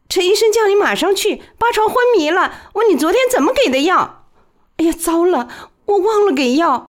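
high, fast, anxious woman's voice; urgent nurse. A narrator's reading. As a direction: hurry the message out. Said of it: "一个女人急切地说".

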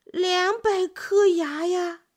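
慢慢地, 一字一字认真讲; 当真地解释; 认真地说. A narrator's reading. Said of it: "蜗牛认真地说". (蜗牛认真慢慢地回答)

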